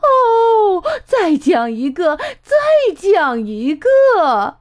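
high, loud eager begging; eager pleading. A narrator's reading. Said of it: "妈妈急切地请求".

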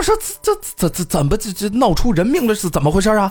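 high stuttering alarm; mock panicked officials; shocked stammer.

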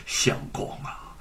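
softly whispered in sorrow; tender grieving whisper; pained whisper. A narrator's reading. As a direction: breathe it out in heartache. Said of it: "痛惜地说".

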